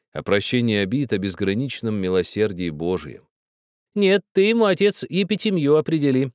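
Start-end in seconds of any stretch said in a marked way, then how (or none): 4.0-6.3 put-on high, pressing old woman's voice; insistent old woman. A narrator's reading. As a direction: insist like a stern grandmother. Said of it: "настаивала бабушка Лукерья".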